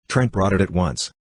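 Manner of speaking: usual speaking voice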